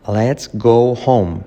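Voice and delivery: slow, drawn-out LET'S GO HOME; slow model take. Shown as a demonstration. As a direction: stretch each word of "Let's go home" for learners. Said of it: "Pero mirado rápido es así".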